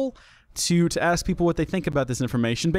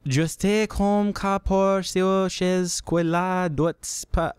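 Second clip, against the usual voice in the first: slow, drawn-out attempt at reading French; mock French; 4 semitones higher, much slower than usual. Host trying to read the original French post, halting mock French. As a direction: fumble through French in a put-on French accent. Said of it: "in the original French".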